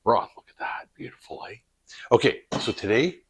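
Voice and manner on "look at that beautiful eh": hushed whisper of admiration; admiring the jars in a whisper. Real, not acted. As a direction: whisper it like you're showing off a treasure. Admiring the canned beef jars.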